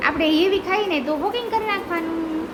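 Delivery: usual speaking voice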